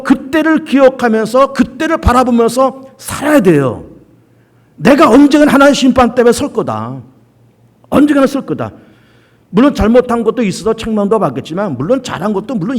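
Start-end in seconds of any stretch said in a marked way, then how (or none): none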